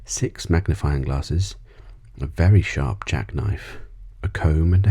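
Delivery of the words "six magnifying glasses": usual speaking voice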